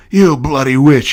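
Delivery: shouted curse; yelled insult. Acted. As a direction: bellow it in outrage. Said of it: "he yelled back".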